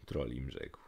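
ordinary delivery

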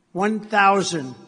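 slow, loud automated altitude call; computer voice. Retold or imitated. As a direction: announce it like the plane's machine. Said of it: "it's a computer voice".

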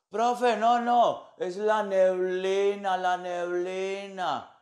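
slow, drawn-out student excuse; spaced-out student. Acted. Student excusing the smoke to the teacher, slow and spaced-out. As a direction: stretch the words like a dazed pupil.

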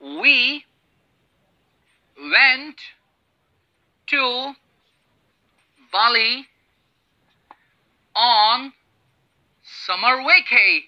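very slow, each word separated by long pauses; slow dictation of the example. Shown as a demonstration. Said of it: "हम ये कह सकते हैं".

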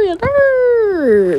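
"Yes, sir" in a high, loud put-on voice, dragged out and falling on "sir". Hyped repeated catchphrase.

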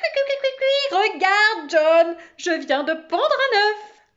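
piaillements suraigus et très forts; cris d'oiseau exaltés. Acted. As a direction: piaille et crie comme un oiseau déchaîné. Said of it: "criait-elle". (cris perçants de maîtresse-oiseau)

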